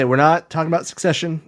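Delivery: ordinary talk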